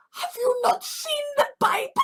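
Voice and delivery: high, tearful mock plea; a mock sob of disbelief; exasperated plea. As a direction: beg them, voice cracking high. Exasperated quote of himself.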